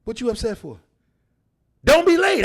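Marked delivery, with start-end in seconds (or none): 1.8-2.4 emphatic shout. Real, not acted. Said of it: "emphatic advice".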